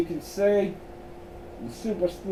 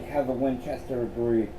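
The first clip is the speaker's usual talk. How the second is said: usual speaking voice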